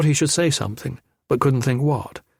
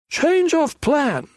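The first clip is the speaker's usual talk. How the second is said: much higher, slow thug voice; shrill-voiced thug; much slower than usual. A narrator's reading. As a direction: squeak it out lazily to his partner. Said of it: "he told his partner".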